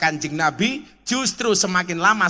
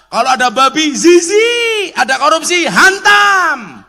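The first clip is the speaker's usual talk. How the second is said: loud, high preaching shout; fiery shout; 9 semitones higher, 9 dB louder than usual. Real, not acted. Preacher rousing the crowd against corruption, fiery shout. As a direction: hammer the crowd with it.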